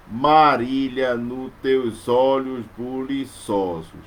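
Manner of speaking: slow, syllable by syllable; slow metrical scansion; slow scansion reading. Shown as a demonstration. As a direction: read the verse slowly, counting beats. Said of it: "a sílaba forte está no O de olhos".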